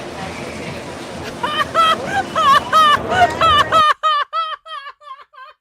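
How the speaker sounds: high, loud, helpless laughing fit; loud burst of laughter. Real, not acted. Streamer cracking up at the Budweiser clip, loud laughing fit.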